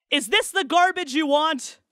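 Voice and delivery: distant shouted question; rabbi shouting. A narrator's reading. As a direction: call it up from below. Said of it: "The shout echoed toward him".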